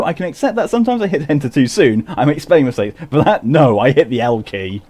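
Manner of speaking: amused self-defence; laughing about hitting the wrong key; laughing at own typo. Real, not acted.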